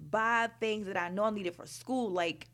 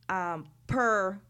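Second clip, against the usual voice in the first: usual speaking voice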